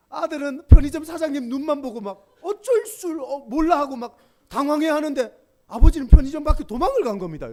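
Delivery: preacher pressing the point loudly; full-force preaching. Real, not acted. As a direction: drive it home to the congregation.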